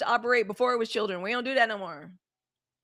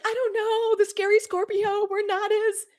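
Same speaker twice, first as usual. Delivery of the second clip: high, put-on innocent voice of herself; mock naive self; 10 semitones higher, 4 dB louder than usual. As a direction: squeak it out like a clueless mom. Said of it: "I was like".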